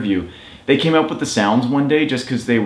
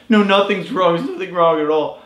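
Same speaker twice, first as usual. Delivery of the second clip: mock tearful denial; 7 semitones higher than usual. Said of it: "And you're like".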